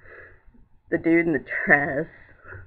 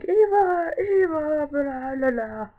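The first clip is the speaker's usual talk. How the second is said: calling out; 12 semitones higher, slower than usual. Retold or imitated. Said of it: "they're like".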